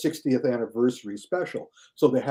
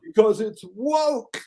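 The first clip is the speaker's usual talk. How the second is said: whiny critic; 8 semitones higher, slower than usual. Retold or imitated. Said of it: "are all going".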